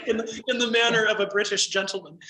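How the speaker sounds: a laughing hint of a British gentleman's accent; mock British gentleman. Joking to the clerk about reading aloud, in a light mock British accent. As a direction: toss off a half-done posh British accent as a joke. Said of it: "use a funny accent".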